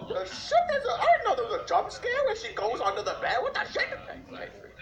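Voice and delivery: high shocked laughing cries. Real, not acted. Jump scare reaction.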